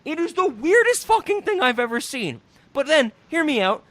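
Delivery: loud, high disbelief; ranting to Ben about the scene; incredulous rant. Real, not acted. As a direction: pitch up, almost yelling. Excited rant about the movie.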